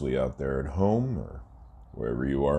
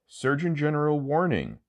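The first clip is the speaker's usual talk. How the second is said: high announcer; 11 semitones higher than usual. Acted. Mock disclaimer read.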